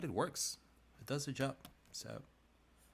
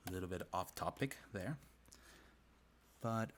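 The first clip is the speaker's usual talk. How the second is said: ordinary talk, nothing marked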